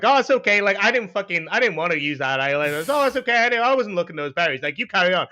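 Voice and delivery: high, mock-polite muttered-for-all-to-hear comments; put-on politeness with a dig in it; passive-aggressive. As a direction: feign being fine, with a high, pointed "oh". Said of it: "passive aggressive comments".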